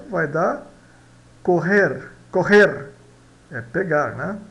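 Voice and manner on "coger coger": "correr" with the middle consonant as a rough H, like Portuguese double R; Spanish G pronunciation. Shown as a demonstration. Teacher showing how coger sounds, Spanish G pronunciation. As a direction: model "correr" as an isolated word, middle sound aspirated. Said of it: "soa dois R's".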